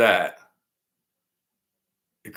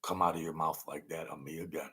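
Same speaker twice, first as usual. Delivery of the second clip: menacing threat; 4 semitones lower, 7 dB quieter than usual. Retold or imitated. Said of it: "He said".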